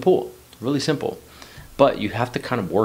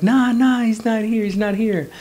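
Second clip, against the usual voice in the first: much higher, louder denial; imitated shouting back; 7 dB louder than usual. Friends covering for him to his mom, mock yelling back. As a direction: call it back across a distance.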